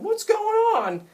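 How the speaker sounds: high-pitched mock panic; freaking out. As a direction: squeal it like a dude freaking out. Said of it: "the dudes are freaking out".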